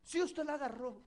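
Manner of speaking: sharp, loud, high mock accusation; mother's accusation. Mother insisting her son took the piggy bank, mock angry accusation. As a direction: snap back at the child as the accusing mother. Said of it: "mother accusing child".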